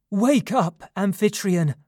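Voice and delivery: high, loud wake-up call; loud alarmed cry. A narrator's reading. Mother waking Amphitryon at night, crying out loudly. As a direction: cry out to rouse a sleeper. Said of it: "she cried loudly".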